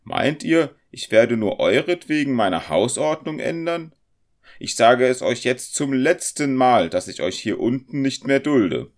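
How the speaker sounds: high, loud scolding; wrathful landlady laying down the law; angry. A narrator's reading. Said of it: "Die Frau sah ihn zornig an".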